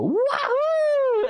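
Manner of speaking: playful high yell of thrill; wahoo whoop. Retold or imitated. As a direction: whoop it like diving into rapids. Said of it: "I'd always just go".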